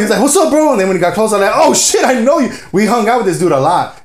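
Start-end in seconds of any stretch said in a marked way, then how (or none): 0.2-0.7 high-pitched retold hail to a buddy; excited greeting. 1.5-2.5 high, excited re-enacted realization; light up as you place an old friend; surprised recognition. Retold sudden recognition of friend.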